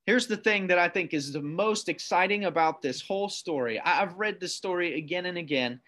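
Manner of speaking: ordinary delivery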